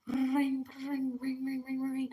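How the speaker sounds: high sing-song ring ring; phone ringing sound. Retold or imitated. Telling friends her phone rang at 4 am, sing-song ring imitation.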